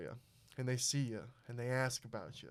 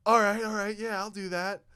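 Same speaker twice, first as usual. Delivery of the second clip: higher and much louder, quick eager yes; eager agreeing; 6 semitones higher, 8 dB louder than usual. Retold or imitated. Nervous freshman agreeing to the coach, high and loud. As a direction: blurt it out to please him. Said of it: "And I'm like".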